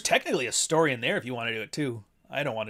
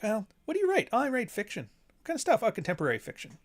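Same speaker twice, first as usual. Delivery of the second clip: acted conversation; 9 semitones higher, faster than usual. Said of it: "now I'm like".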